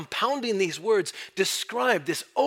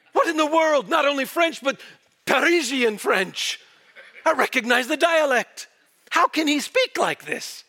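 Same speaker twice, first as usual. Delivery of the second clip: high-pitched, amused disbelief; incredulous onlooker; 5 semitones higher than usual. Acted. Acts onlookers hearing a hick's French.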